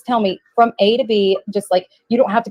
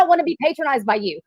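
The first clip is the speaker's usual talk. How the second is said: normal talking voice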